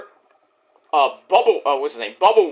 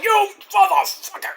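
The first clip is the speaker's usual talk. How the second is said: imitating a yell; 13 semitones higher than usual. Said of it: "yelling at him and shit".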